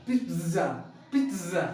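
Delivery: pizza repeated as "pit-zza", buzzing Z; correct pizza pronunciation. Shown as a demonstration. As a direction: separate model takes of pizza with TZ. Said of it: "it's not a pizza". (pizza को "पिट्ज़ा" दोहराएँ)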